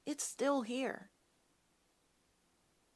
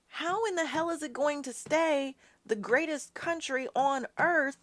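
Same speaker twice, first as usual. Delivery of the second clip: real anger; 5 semitones higher than usual. Real, not acted. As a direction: let the outrage build until you're almost shouting. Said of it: "and I'm pissed".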